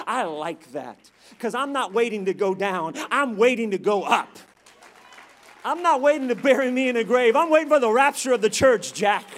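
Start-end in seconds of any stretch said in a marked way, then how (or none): 1.4-9.3 full-force preaching. Real, not acted. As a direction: build to a triumphant pulpit shout.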